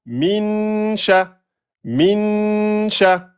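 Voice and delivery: min sha, n held two beats; two-beat noun. Shown as a demonstration. Teacher showing students the two-beat noun. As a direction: stretch the n of "min" before "sha". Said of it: "vous le faites bien en deux temps".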